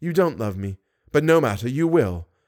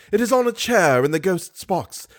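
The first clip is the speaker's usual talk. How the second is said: plain reading voice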